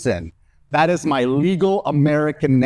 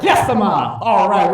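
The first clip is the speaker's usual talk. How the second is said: higher, sharp acted exasperation; exasperated; 5 semitones higher, 3 dB louder, slower than usual. Son reacting to mom's phone call, exasperated. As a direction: cut mom off in mock alarm.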